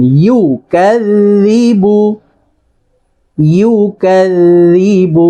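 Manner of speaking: "يكذب" chanted slowly with a rounded, long "yu"; rounded lips. Shown as a demonstration. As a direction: round the mouth on "يكذب" and draw it out. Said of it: "মুখটাকে গোল করে পড়বেন". ("يكذب", মুখ গোল করে টেনে পড়া)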